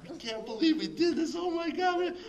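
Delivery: very high, excited retold squeal; giddy disbelief. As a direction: reenact breathless glee after a getaway. Giddy escape to the car.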